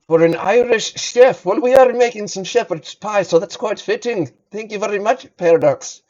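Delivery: hammed-up Irish accent. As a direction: put on an Irish chef voice for the redemption. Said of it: "Sorry, I forgot the accent".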